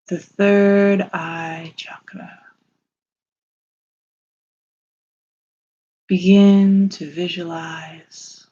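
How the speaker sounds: slow, soothing meditation voice with long pauses; unhurried, calming lead through a visualization; guided meditation. Acted.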